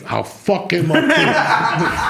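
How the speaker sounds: slow, loud, laughing through it; laughing threat. Real, not acted. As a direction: cackle the threat out. Punchline while laughing.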